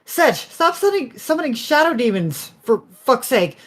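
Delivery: high-pitched exasperated scolding; loud, fed-up reprimand; yelling. Acted. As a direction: yell at them to cut it out.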